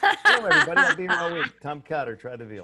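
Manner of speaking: loud hammy nightclub comic; borscht belt comic. Pretend. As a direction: sell it like an old lounge comic. Mock standup closer after joke.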